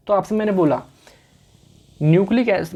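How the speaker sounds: ordinary delivery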